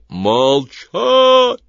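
loud thundering command; god voice. A narrator's reading. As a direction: thunder it like a wrathful deity. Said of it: "прогремел голос". (громовой голос божества)

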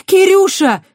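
very high, loud yell; shout. A narrator's reading. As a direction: scream his name as they block her. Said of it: "выкрикнула Кристина". (громкий отчаянный крик)

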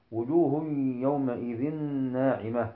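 verse chanted evenly; Quran recitation. Shown as a demonstration.

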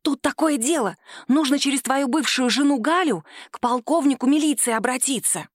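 ordinary delivery, nothing marked

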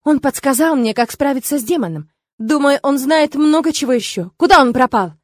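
insistent, ending on a loud demand; impatient exclamation. A narrator's reading. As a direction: push urgently toward the final question. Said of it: "нетерпеливо воскликнула я".

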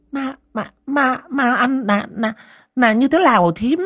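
frightened mother tripping over her words; stammering in fear. A narrator's reading. Mother Ngà asking the aunt about ghosts, stammering in fear. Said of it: "lắp bắp".

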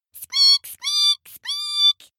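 tiny, extremely high squeals; squeaking mice. Acted. Mice chasing Haddo down the hall, shrill squeaking.

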